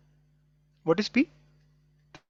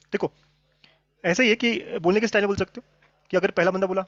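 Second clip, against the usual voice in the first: ordinary talk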